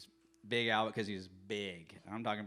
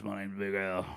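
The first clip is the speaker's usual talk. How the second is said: lightly voiced big dude; light imitation of Big Al. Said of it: "He talked like this".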